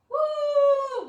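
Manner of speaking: a big high whoop of celebration; joyful loud woo; excited cheer. Real, not acted. Cheering staff appreciation week.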